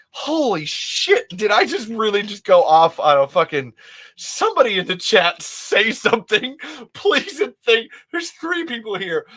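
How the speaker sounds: high, loud comic desperation; flustered outburst. Real, not acted. Streamer begging the chat to say something, flustered and high. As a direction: plead loudly, pitched well up.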